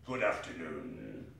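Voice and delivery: low, slow send-off; icy, low-pitched farewell; curt dismissal. Acted. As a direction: dismiss him in a deep drawn-out voice. Scrooge dismisses his nephew.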